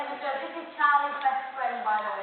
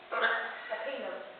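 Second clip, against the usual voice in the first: slow and drawn out; 4 semitones lower, 7 dB quieter, much slower than usual. Shown as a demonstration. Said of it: "you said them very quickly".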